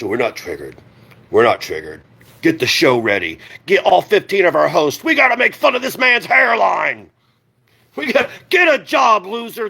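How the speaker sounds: mock whine of denial, rising to a jeer; mocking the hosts. Geek Room hosts insisting they aren't triggered, mocking whine. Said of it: "telling themselves they're not".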